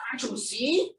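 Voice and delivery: ordinary delivery, nothing marked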